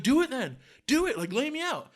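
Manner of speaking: high, imitated drunk dare; goading. Imitating drunk daring him.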